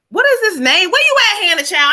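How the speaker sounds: excited question shouted toward the unseen grandma; calling out to Grandma. Real, not acted. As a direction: reach out to the spirit with urgency. Medium calling out to spirit.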